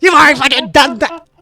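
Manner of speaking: loud angry snap; pissed off. Acted. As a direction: bark it back, furious. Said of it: "stop himself. He's just real pissed".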